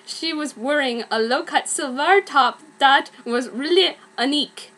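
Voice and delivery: ordinary delivery, nothing marked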